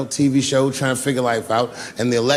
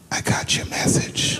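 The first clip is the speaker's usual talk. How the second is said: breathy secret whisper; whispered in the ear; slower than usual. Retold or imitated. Obama pulling the comedian close, secret whisper in his ear. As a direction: lean in and whisper it, confidential. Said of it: "He said in my ear".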